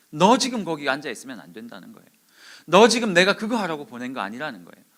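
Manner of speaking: loud, firm reproach; forceful rebuke. Retold or imitated. Preacher relaying God's rebuke to Elijah, forceful. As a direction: push each sentence out hard. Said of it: "엘리야에게 강하게 말씀하셨어요".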